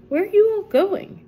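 mild surprise at strange guests; curious man. Acted. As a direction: ask brightly, a touch surprised. Man asking the visitors.

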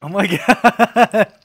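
words dragged out through laughter; real laughing disbelief. Real, not acted. Reacting to a friend's outrageous joke, laughing.

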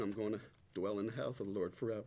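normal talking voice

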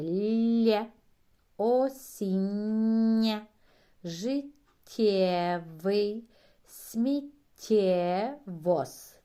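loud and slow, stretching the soft consonants; teacher's slow model reading. Shown as a demonstration. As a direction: drag out the doubled soft consonants. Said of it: "ми чуємо пом'якшення попереднього".

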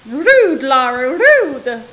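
loud, slow mock outrage; playfully scolding Lara out loud; mock offended scolding. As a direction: scold her like a put-out aunt. Scolding the game character.

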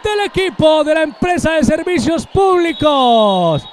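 high, loud goal call; breakneck goal commentary. Real, not acted. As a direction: scream the goal, stretched and soaring. Goal scored, commentator erupts.

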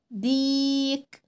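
ديك with a drawn-out vowel; long ii in ديك. Shown as a demonstration. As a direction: stretch the ii of ديك. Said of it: "ونطول الصوت قوي".

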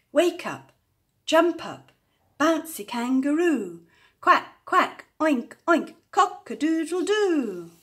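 high, bouncy calling out; loud wake-up call. A narrator's reading. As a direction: rouse everyone with lively farmyard cries. Rousing sleeping animals, farmyard calls.

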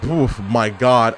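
ordinary delivery

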